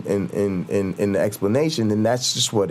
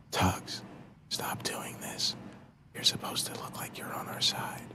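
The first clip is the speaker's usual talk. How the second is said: urgent whisper, much softer; whispered aside; 15 dB quieter than usual. Retold or imitated. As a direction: hiss it low so nobody else hears. Quietly warning a partner mid-show.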